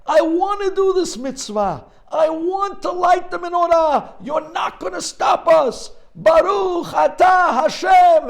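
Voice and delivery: very high, loud desperate scream; boy shrieking at the window. Retold or imitated. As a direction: scream after the men being dragged away. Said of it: "and he screams".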